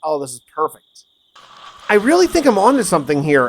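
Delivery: usual speaking voice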